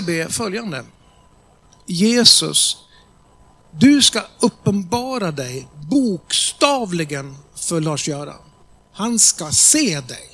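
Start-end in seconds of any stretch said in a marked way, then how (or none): 1.9-10.3 slow, louder prayer voice, each word laid down; bold commanding prayer. Shown as a demonstration. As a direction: pray it boldly, as a command. Leading a prayer aloud.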